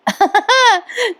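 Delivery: short high-pitched laugh. Real, not acted. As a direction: burst out in a brief, high laugh. Narrator laughs at boast. (उंच आवाजातलं छोटं हसू)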